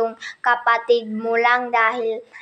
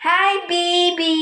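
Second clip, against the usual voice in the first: slow cooing; 4 semitones higher, slower than usual. Acted. Cooing to baby.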